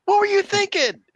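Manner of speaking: high, loud fretting voice; worried scolding. Acted. Tess fusses over the beaten hero, worried scolding. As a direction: scold while handing over fruit.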